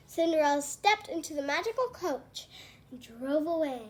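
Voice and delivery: usual speaking voice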